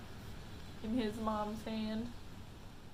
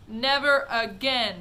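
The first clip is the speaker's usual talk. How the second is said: slow, loud firm shout; 2 semitones higher, 11 dB louder, slower than usual. A narrator's reading. Father shouting his ban on television. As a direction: put your foot down. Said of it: "shouted Mister T V".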